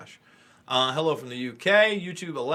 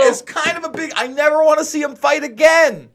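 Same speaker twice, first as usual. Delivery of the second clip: heated rant; 13 semitones higher, 10 dB louder than usual. Real, not acted. Passionate rant.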